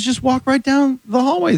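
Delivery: high, easygoing officer voice; cheerfully friendly Canadian guard; nice customs officer. Retold or imitated. Officer directs comedian down the hallway, high and friendly. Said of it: "And he was so nice".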